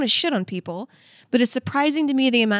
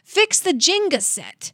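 mock scold; 6 semitones higher, slower than usual. Mock scolding a lazy friend.

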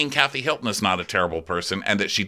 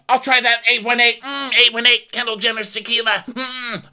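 high, loud put-on squeal; hammed-up high-pitched shouting; mock yelling; 4 dB louder than usual. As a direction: yell it in a mocking high voice.

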